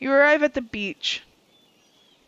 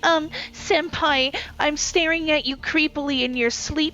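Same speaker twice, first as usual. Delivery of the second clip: breathy cutesy giggly; 4 semitones higher than usual. Retold or imitated. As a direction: coo it like a creepy admirer. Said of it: "Wraith was like".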